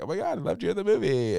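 high, fast fangirl squeal; gushing fan. Retold or imitated. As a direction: gush like a starstruck fan.

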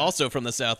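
ordinary delivery

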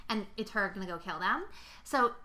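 normal talking voice

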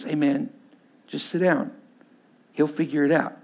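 low, hushed reassurance, in short pieces; calming friend. Retold or imitated. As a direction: imitate a buddy quietly talking someone down. Friend calms the angry teller.

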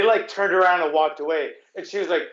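usual speaking voice

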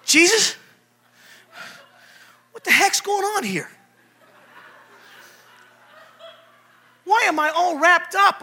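Lazarus, newly risen, baffled by his wrappings; confused Lazarus. Retold or imitated. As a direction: wake up confused, wrapped in cloth. Lazarus wakes in grave clothes.

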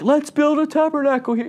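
very high-pitched, overexcited squeal of words; freaking out. Acted. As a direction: play a flustered disciple freaking out. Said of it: "freaking out".

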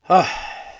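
long unvoiced exhausted sigh; heavy sigh. Real, not acted. Podcaster deflating after his rant, long weary sigh. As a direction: let the anger drain out in one breath.

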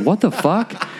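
mock stunned disbelief; baffled. Guest answering the owner's accusation, mock baffled. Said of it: "I was like".

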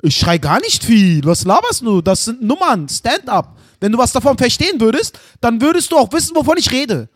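mock-angry dad defending himself; shouting denial. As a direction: protest loudly, as if outraged. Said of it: "du schreist doch selber gerade".

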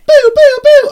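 high loud wailing boo siren; alarm siren imitation. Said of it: "Oh, what was that?".

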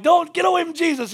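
high, loud acted warning; alarmed parents. Preacher voices parents warning their child, mock alarm. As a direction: play a panicked parent calling a child back.

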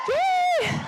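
high, shouted cheer; excited cheer. Real, not acted. Host announcing a guest speaker, cheering excitedly. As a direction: whoop it up for the news.

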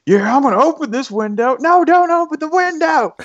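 very high, hammed-up Texan squabble; mock outrage in a high, strained voice; super huffy. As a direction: imitate grown men bickering in a huff. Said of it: "super huffy with".

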